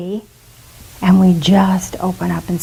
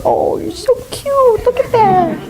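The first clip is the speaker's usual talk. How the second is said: put-on very high, tender baby-talk coo; doting coo; 12 semitones higher than usual. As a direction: coo like a parent over a cute baby. God voiced adoring a little kid.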